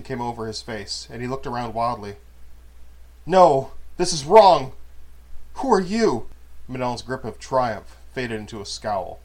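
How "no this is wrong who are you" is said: higher, much louder frightened shouting; panicked outcry. Acted. Confused man crying out at Manon in panic. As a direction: cry out in confused terror.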